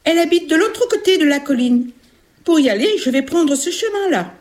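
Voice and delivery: put-on high-pitched innocent child; little girl. Little girl showing the ogress her way, high child voice. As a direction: explain the way like a small girl.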